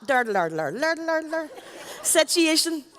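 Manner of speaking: lightly mimicking Northern Irish sound, lur lur lur; Northern Irish lilt. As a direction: hum the Northern Irish tune with "Lur" syllables. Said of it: "we do speak like that".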